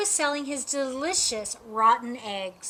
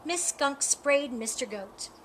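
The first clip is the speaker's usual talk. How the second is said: ordinary delivery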